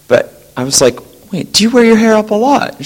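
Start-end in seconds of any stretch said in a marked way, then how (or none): 1.3-2.7 surprised question. Retold or imitated. Retold question to colleague.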